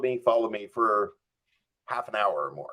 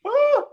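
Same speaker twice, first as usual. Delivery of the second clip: sharp, very high yelp; startled embarrassed yelp; 5 dB louder than usual. Real, not acted. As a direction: let out a sudden high yelp. Embarrassed outburst at the memory.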